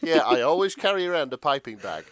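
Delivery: ordinary delivery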